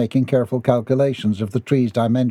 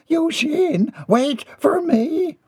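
very high, drawn-out call; high-pitched plea to be waited for; woman calling out; much slower than usual. Acted. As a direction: call after someone to wait, stretching the words. Woman calls after crawling Mortimer.

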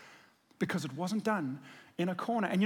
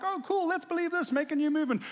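high, airy mock enthusiasm; a breezy, sing-song parody of easy belief; mock gullible; 8 semitones higher than usual. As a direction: play a naive fool, lightly. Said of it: "someone made up and is like".

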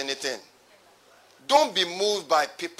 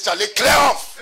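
bold command; 5 semitones higher, 6 dB louder than usual. Pretend. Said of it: "Faith says".

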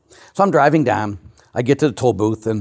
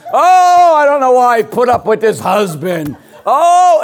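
wailing "Oh" much higher and louder, then slow laughing moans; complaining parishioner; 7 semitones higher, 6 dB louder, much slower than usual. Retold or imitated. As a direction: ham up a self-pitying churchgoer lamenting. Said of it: "Just complain, complain, complain".

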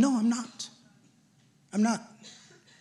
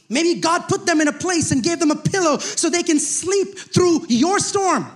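impassioned preaching; 6 semitones higher than usual. Acted. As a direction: drive the point home to the congregation. Preaching at full force.

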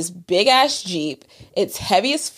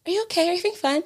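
much higher, softer girly mock voice; imitating a sweet girl; 9 semitones higher, 5 dB quieter, much faster than usual. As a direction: coo it like a fake-concerned girl. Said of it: "one of the girls was like".

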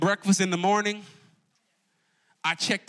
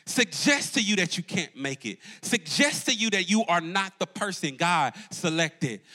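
normal talking voice

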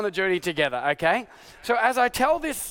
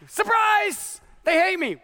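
sarcastic surprise; 8 semitones higher, slower than usual. Retold or imitated. Pastor mocking his own surprise at being hated, sarcastic high chirp.

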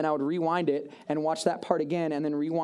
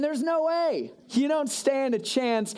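scoffing; 7 semitones higher, slower than usual. Acted. The king's real doubt.

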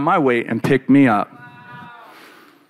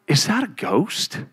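soft, slow, spooked question; frightened hush; 6 dB quieter, slower than usual. Retold or imitated. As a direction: ask it hushed and scared. Frightened disciples see figure.

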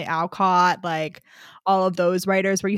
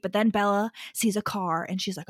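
ordinary delivery

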